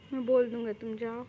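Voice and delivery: ordinary talk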